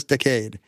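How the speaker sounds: "decade" stressed on the second syllable, de-CADE; Kennedy pronunciation. Retold or imitated. Host pledging a species goal, "decade" as de-CADE. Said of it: "Remember how Kennedy always said".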